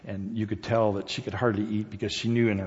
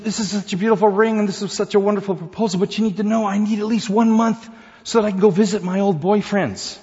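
high, loud and fast sweet woman's voice; Susan's voice; 11 semitones higher, 9 dB louder than usual. Acted. Fiancée breezily tells Johnny her condition in a high voice. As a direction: gush it quickly in a high sweet voice.

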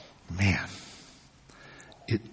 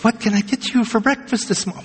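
higher, sing-song order-taking voice; perky barista; 10 semitones higher, faster than usual. Retold or imitated. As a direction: chirp the question brightly. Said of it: "And she goes".

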